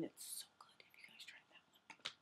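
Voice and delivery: hushed aside whisper. Real, not acted. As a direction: whisper it like a secret. Aside about favourite sunscreen.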